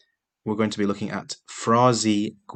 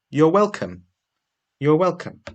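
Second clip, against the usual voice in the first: quiz prompt read aloud; 7 semitones higher, 3 dB louder than usual. Shown as a demonstration. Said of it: "So the first one is".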